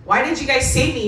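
pleading yell at doctors who saved her; retold yelling. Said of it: "I start yelling at the doctors".

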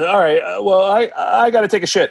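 high, hurried-sounding bail-out; flustered excuse. Retold or imitated. Starstruck caller making up an excuse, flustered. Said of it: "then I made up an excuse".

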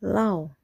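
"lao" short, pitch dropping; falling tone. Shown as a demonstration. Teacher's third tone example, "lao" falling. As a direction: let "lao" fall. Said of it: "The third tone is a falling tone".

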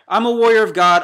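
loud, proud battle cry of faith; defiant declaration. Real, not acted. Preacher to doubting viewers, a loud defiant shout. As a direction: proclaim it like a warrior.

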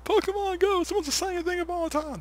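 high, loud hammed-up fan squeal; mocking excited fans. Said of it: "everybody's like".

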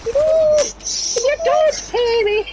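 very high, drawn-out squeal; mocking imitation. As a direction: mimic a friend's squeaky voice, stretched slowly. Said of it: "That's what you sound like".

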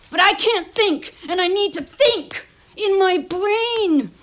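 loud, slow, high-pitched cry; frustrated outburst. Acted. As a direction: drag out the key words in frustration. Frustrated outburst, cannot think.

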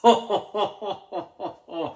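a loud real laugh breaking out; burst of real laughter. Real, not acted. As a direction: laugh out in disbelief. Tasting the awful beer.

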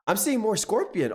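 normal talking voice

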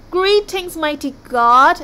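loud, high formal hail; grand greeting. A narrator's reading. Viking greeting a mighty sea god, grandly. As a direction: proclaim it grandly, drawing out the end.

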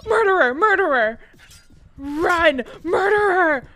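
loud, high cries of alarm; panicked shout. Real, not acted. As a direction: yell while fleeing a killer. Being chased in game.

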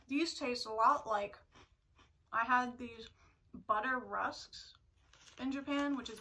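speaking between bites; talking while chewing. Real, not acted. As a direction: mouth full of snack. Seen 2: Bites snack at 0.6, chews through 1.8, keeps talking after.

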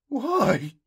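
high, loud anguished cry; sobbing plea. A narrator's reading. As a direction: wail it in tearful bewilderment. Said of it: "he sobbed".

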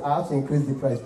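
usual speaking voice

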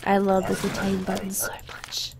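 gleeful whispered aside; hushed delight at the detain button. Real, not acted. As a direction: whisper it with glee.

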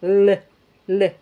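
isolated "L" made just behind the teeth; tongue-tip L. Shown as a demonstration. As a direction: tap out a plain l behind the teeth. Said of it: "yo pronuncio el sonido L".

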